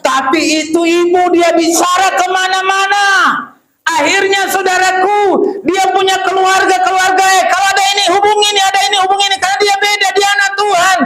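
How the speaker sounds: high, loud shouted preaching climax; preaching at full force. Acted.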